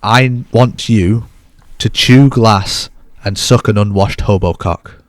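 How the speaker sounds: very slow, measured reading; deadpan, drawn-out pronouncement; mock-solemn threat. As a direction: draw out each word with grave deliberation. Reads James's threat with mock solemnity.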